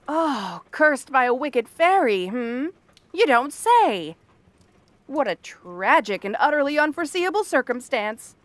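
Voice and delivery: theatrical sarcastic astonishment; exaggerated, lifted-pitch mock dismay; mock sympathy. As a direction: feign shock at news you saw coming. Mocks the tale of a curse.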